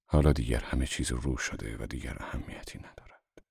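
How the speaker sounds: low, hushed, grave male figure; quietly resolute, pitched well down; firm gravity. A narrator's reading. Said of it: "با صلابت گفت". (با صلابت، بم و آهسته)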